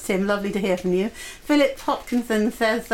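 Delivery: ordinary delivery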